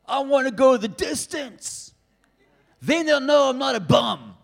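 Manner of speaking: a hammed-up Italian-American Philly accent as Rocky; Sylvester Stallone accent. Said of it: "I'll try and put the accent on like he did it".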